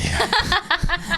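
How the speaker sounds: high burst of laughter. Real, not acted. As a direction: let out a high giggle. Laughs at his own joke.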